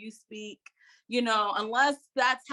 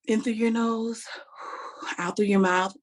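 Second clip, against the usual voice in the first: audible exhale through the mouth between the words; breathing demo. Shown as a demonstration. Coach shows nervous speakers how to breathe, audible exhale. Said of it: "don't be afraid to".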